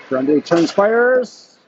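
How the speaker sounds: rising to a shout as the shot goes; excited call. Real, not acted. Commentator calling a shot on goal, excited shout. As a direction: pitch jumps high and loud on fires.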